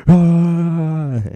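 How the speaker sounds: high drawn-out mock yell; imitated gibberish yell. Imitating a friend on a bad trip, gibberish yell. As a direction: wail one long nonsense vowel. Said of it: "saying some things in gibberish".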